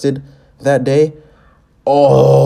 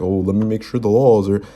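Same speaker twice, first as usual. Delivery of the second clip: lower, prissy mimic; mock fussy; 5 semitones lower than usual. Reactor imitates Greg's legal caution, lower mock. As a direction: play him checking rules, smug and lower. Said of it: "so you're just like".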